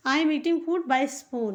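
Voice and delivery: slow example sentence. Shown as a demonstration. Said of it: "इस तरह से बोल सकते हैं".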